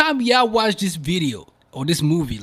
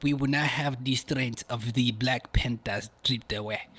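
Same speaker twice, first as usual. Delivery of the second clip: slow, mocking African movie accent; 2 semitones lower, 4 dB quieter than usual. Podcast host mocks an actor's movie line, in a put-on African accent. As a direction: exaggerate a bad African accent, drawn out. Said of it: "We ain't buying that accent".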